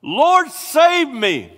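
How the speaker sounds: high, loud, slow desperate cry; cried out. A narrator's reading. Peter sinking in the sea, crying to the Lord in panic. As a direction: cry out as you go under. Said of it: "he cried out".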